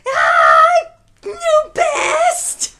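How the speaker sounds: very high, loud victory scream; ecstatic scream. Real, not acted. Player beating a high score, ecstatic scream. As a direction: shriek it in triumph.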